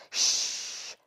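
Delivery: breathy shush; hush. A narrator's reading. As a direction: shush her so the story goes on. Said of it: "said Jake".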